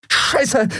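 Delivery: breathless curse after losing her weapon; panted curse. Acted. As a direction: spit it out breathless mid-fight. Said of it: "keuchte sie".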